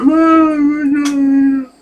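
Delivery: high, slow squeaky creature voice; mock spider, high and drawn out; little spider voice. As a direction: voice a tiny spider.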